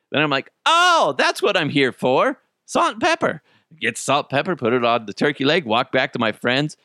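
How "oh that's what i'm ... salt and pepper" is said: high, loud dawning realization; stoned realization blurted out high; sudden realization. Retold or imitated. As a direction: squeal it as the penny drops.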